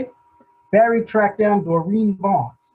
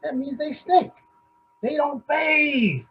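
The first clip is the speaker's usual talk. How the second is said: disgusted shout; 7 semitones higher, slower than usual. Acted. Disgusted rant about monks.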